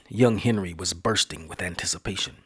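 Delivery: usual speaking voice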